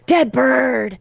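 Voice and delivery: lightly imitating a sinister disembodied voice; menacing voice. Retelling the ghost voice on the audio file, lightly menacing. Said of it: "this really menacing voice goes".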